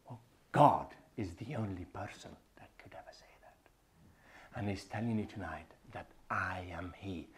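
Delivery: unvoiced whisper, soft; hushed whisper. Real, not acted. Preacher confiding to the congregation, whispered. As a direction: lean in and whisper it.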